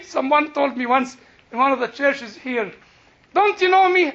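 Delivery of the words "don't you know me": indignant self-importance. Retold or imitated. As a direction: imitate an affronted celebrity, high and loud. Retells a celebrity demanding recognition.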